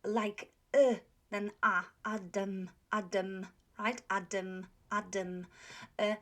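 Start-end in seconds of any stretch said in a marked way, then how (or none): 2.1-5.5 "Adam" with a weak "uh" second vowel; British pronunciation. Shown as a demonstration. Teacher showing how English speakers say it, reduced vowel. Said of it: "British way, uh, you would hear".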